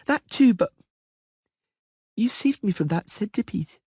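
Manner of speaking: put-on high girlish voice, stubborn and petulant; a spoiled little princess making her case; young girl Ciri. A little princess arguing with the Witcher, high girlish voice.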